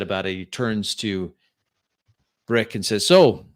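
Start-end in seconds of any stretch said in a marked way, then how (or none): 3.1-3.5 louder, higher, quick driver voice; loud over engine. Acted. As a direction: raise your voice over the engine. Driver character talks over wind.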